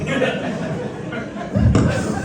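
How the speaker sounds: a burst of high, real laughter. Real, not acted. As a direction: laugh it off at the brother's fate. Laughs at brother's fate.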